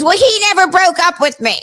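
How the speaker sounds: high, protesting voice of the ex; put-on high girl voice; imitated caller. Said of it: "And she goes".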